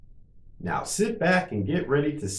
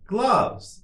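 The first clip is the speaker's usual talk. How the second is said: much higher, shorter take; quicker model; 12 semitones higher than usual. Shown as a demonstration. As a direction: say it quickly on a raised pitch. Teacher models word for repetition.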